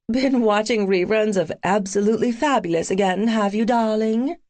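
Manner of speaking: mock posh British accent; playful fake British accent; best British accent. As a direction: put on a British accent, teasing. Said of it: "I said in my best British accent".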